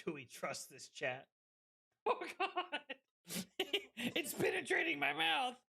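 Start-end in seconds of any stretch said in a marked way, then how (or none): 2.1-5.5 words broken up by laughter; laughing. Real, not acted. Laughing at the drink.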